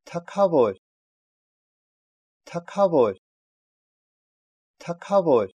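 "таковой" said as "такавор", puffed k; voiceless "к" mid-word. Shown as a demonstration. Teacher giving an example for learners, "таковой" pronounced "такавор". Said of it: "читается ака".

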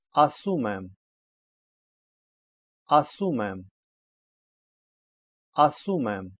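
"Асумем" stressed on СУМ, the last syllable of the participle; careful demo of "Асумем", middle syllable stressed; stress on participle ending. Shown as a demonstration. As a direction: lean on "сум" in "Асумем", letting "ем" fall away. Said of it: "Ударение ставится на последний слог".

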